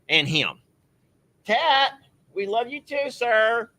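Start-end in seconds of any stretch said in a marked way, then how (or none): none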